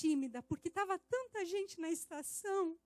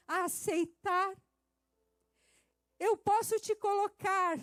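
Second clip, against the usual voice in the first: preaching at full force; 5 dB louder, slower than usual. Acted. Preaching God's words.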